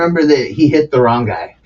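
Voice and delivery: ordinary talk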